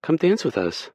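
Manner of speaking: high, bright, playful coaxing; smiling invitation. Acted. Rachel invites Kay to dance, smiling and playful. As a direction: pull him up with a smile. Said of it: "she says with a smile".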